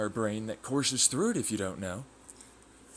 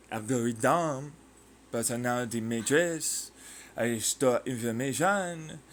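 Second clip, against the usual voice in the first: slow, mock French-accented delivery; hammy French accent; put-on French accent. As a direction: draw the words out in a fake French accent. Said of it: "I don't do this accent".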